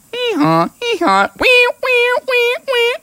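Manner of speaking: squeaky hee-haw then high wees; very high donkey noises for a child; high donkey bray. Acted. Donkey braying in story.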